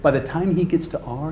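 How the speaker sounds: normal talking voice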